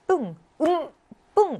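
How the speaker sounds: explosive 뿡 released from closed lips; bursting p. Shown as a demonstration. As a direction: burst the tense p of 뿡. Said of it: "뿡 하고 터지는".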